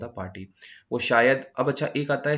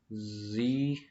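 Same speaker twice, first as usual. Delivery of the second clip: usual speaking voice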